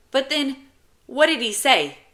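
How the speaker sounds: put-on high, loud little boy; eager kid pressing with questions; child's voice. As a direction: pitch it up like a pestering child. Child James pestering his brother.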